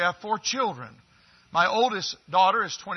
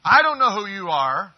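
ordinary delivery

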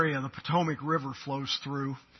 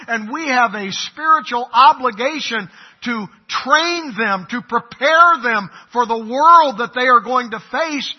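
louder, higher, driving sermon climax; preaching at full force; 8 semitones higher, 12 dB louder than usual. Acted. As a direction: drive it home to the congregation.

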